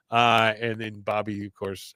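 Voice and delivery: ordinary delivery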